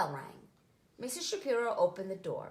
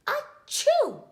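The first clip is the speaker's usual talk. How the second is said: loud high theatrical achoo; a child's sneeze in the story; big sneeze; 12 dB louder than usual. A narrator's reading. Josh sneezing again.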